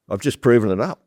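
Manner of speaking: put-on young guy excitedly reporting his win to the boss; retold excitement. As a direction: mimic a junior bursting with news. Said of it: "came to me all excited".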